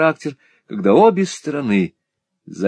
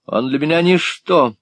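hysterical; 6 semitones higher than usual. A narrator's reading. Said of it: "проявляя все симптомы истерики".

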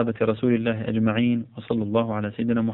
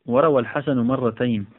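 normal talking voice

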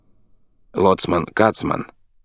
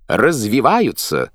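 slow, louder excited shout; 6 semitones higher, 4 dB louder, slower than usual. A narrator's reading. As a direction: call it out slowly. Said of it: "закричал Кацман".

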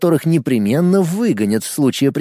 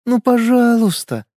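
high, slow coaxing plea; sweet pleading; 9 semitones higher than usual. A narrator's reading. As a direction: beg sweetly, drawn out. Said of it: "милым голосом спросила Надежда".

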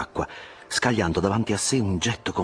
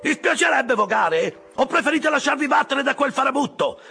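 high-pitched, exasperated yelling at the crew; goading shout; 4 dB louder than usual. A narrator's reading. Officer egging on his rowers in a chase, high loud shouting. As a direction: goad the rowers at the top of your voice. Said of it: "gridava intanto Stabbe al suo equipaggio".

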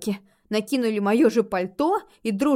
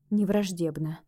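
ordinary delivery, nothing marked

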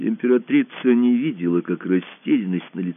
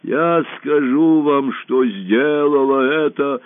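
slow, raised, loud declaration; last proclamation; 6 semitones higher, 5 dB louder, slower than usual. A narrator's reading. Dying empress to the hall, straining proclamation. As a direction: force out each word high and slow.

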